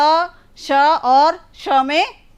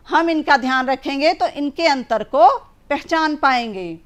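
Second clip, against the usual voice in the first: ordinary delivery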